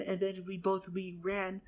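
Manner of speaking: normal talking voice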